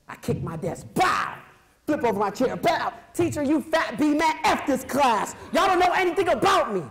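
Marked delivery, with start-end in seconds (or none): 0.9-1.4 high, loud bang noise; loud bow effect. Retold or imitated. Kid kicking his desk over, loud bang sound. 2.5-2.9 bang noise. Retold or imitated. Sound effect of chair flip. 3.1-6.8 put-on high, loud furious kid yell; angry outburst. Angry kid yelling at his teacher and class, furious. Eight-year-old screaming at teacher.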